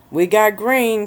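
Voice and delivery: calling out loud from afar. A narrator's reading. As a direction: shout it from the garage. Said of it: "came Mike's loud voice from the garage".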